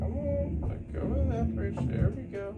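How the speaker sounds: high, soft coaxing; coaxing pet voice. Real, not acted. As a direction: sweet-talk a shy animal out. Coaxing a snake out of cage.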